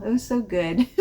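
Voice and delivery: ordinary talk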